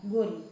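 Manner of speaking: गोरी with a short closed o. Shown as a demonstration. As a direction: say गोरी with a small o sound. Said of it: "अब गौरी ये होती है". (छोटे ओ वाला गोरी)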